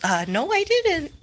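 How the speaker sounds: squeaky high mock denial; retold friend's denial. Vlogger mimics friend denying it, high mock voice. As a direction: pitch it up high, imitating her. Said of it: "And she goes".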